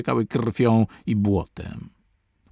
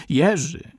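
frantic cry; 6 semitones higher than usual. A narrator's reading. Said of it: "powtarzał jak oszalały".

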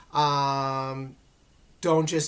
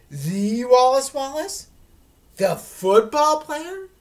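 much higher, louder and slow, wide-eyed disbelief; awed kid; 9 semitones higher, 9 dB louder, slower than usual. Acted. Boy asks his sister about the football star, awestruck. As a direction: gush like a starstruck ten-year-old.

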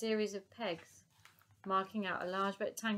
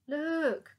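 high, loud excited call; sudden loud cry of look; 8 semitones higher, 7 dB louder than usual. Acted. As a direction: shout to make them look. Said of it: "cried Elsie".

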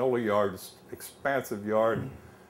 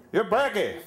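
loud, much higher Pennsylvania Dutch bark; Pennsylvania Dutch shout; 11 semitones higher, 5 dB louder than usual. Retold or imitated. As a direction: shout it at the new guy in a thick Pennsylvania Dutch accent. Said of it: "they talk funny and they shout".

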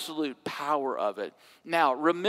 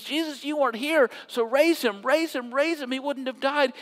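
urgent, raised plea repeating raise him; pleading Martha; 8 semitones higher than usual. Acted. Martha pleading with Jesus, lightly voiced urgency. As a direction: beg him to act, rushing.

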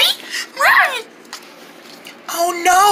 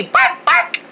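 mock barking; 7 semitones higher than usual. Shown as a demonstration.

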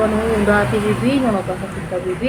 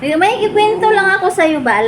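high pet talk; 6 semitones higher than usual. Real, not acted. Calling pet to listen.

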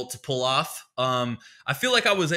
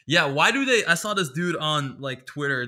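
ordinary talk, nothing marked

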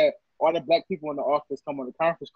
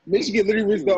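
ordinary talk

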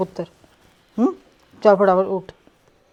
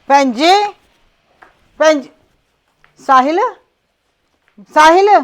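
loud searching calls across the house; anxious calls, rising at the end; calling out; 5 semitones higher, 7 dB louder than usual. Acted. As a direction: call out loudly for them. Calling out searching for missing mother.

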